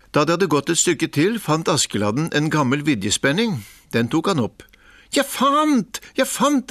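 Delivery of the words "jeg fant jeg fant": high, gleeful shout of discovery; excited call. A narrator's reading. Askeladden calling to his brothers, high and gleeful. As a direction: crow it out, thrilled. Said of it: "ropte han".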